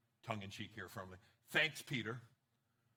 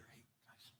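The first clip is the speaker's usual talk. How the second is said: whispered aside; 23 dB quieter than usual. Retold or imitated. Peter pulling the disciples aside.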